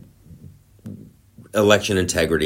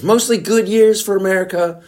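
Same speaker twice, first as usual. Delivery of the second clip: mock high read; 12 semitones higher, 5 dB louder than usual. Mock poll question reading.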